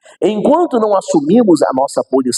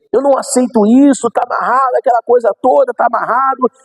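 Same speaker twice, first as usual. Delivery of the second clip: higher, insistent praying mother; mãe intercedendo; 6 semitones higher than usual. Retold or imitated. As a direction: plead like a mother refusing to accept it. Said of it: "a mãe começa a interceder".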